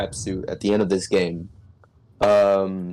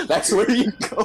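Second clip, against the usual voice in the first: high, laughing through the words; 10 semitones higher than usual. Real, not acted. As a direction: say it while still laughing. Laughing at the lore.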